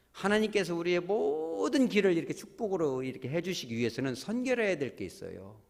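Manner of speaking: ordinary talk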